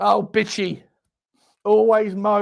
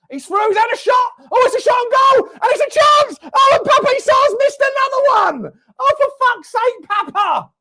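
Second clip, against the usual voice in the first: high loud build to a shout, then groan at the miss; excited shout; 6 dB louder than usual. Real, not acted. Fan screaming as a chance is missed, then cursing.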